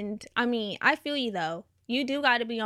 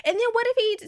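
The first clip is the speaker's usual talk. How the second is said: high-pitched mock worry; hammed-up high fretting; imitating worried friend; 11 semitones higher, 3 dB louder, faster than usual. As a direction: mimic an anxious friend up high.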